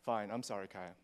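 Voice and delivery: imitating a kid's half-hearted sorry, low and soft; grudging apology. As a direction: mumble a reluctant, insincere apology. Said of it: "his instinct is to be like".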